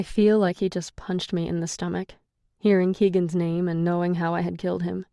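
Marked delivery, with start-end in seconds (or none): none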